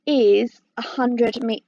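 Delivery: ordinary talk, nothing marked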